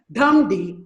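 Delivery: DUM strong, D light; trochaic beat. Shown as a demonstration. Teacher demonstrates a trochee to students, stressing dum before D. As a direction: hit dum first and let D fall away. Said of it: "Stressed followed by unstressed".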